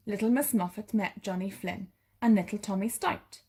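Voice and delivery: usual speaking voice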